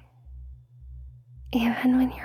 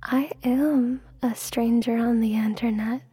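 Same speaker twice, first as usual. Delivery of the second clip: intimate ASMR whisper; breathy hypnotic whisper close to the mic. Acted.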